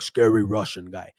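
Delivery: a low, put-on scary Russian accent; terrible Russian accent. Fighter hyped as a scary Russian guy, bad Russian accent. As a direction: growl it like a movie Russian heavy. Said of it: "A terrible accent".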